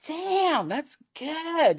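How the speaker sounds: high, amazed exclaim with a laugh; world Debbie voice. Retold or imitated. Her everyday self reacting to a hit, high and amazed. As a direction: squeak it out, impressed. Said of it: "the world Debbie will go".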